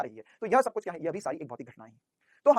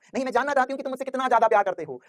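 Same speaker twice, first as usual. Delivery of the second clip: higher-pitched mock girlfriend voice; hammed-up lovers' dialogue, raised and drawn along; insistent girlfriend; 6 semitones higher, 4 dB louder than usual. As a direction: plead insistently like a lover wanting proof. Acting a girlfriend demanding love.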